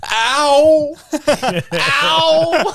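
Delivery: high, hammed-up ow; badly faked hurt yelps; fake pain cries. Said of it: "she's just not the greatest actor".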